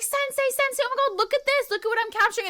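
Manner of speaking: high-pitched, mock-childlike excitement; excited kids. Kids running up to show sensei a bug, excited.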